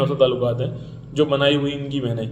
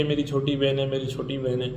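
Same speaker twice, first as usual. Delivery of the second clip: softer, sugary mock of a fake brother; mock sweet talk; 2 semitones lower, 7 dB quieter than usual. Mocking men who sweet-talk so-called sisters, softly sugary. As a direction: coo it like a phony sibling.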